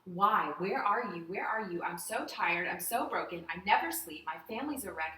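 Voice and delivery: loud, imitated anguished plea; crying out. Mother imitating her kitchen plea to God, crying out. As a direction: plead upward in a retold breakdown, without real tears. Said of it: "I'm crying out to God".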